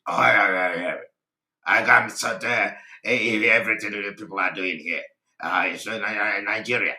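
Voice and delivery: low, halting, stuttering mimicry; man from River State. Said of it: "like the man in River State".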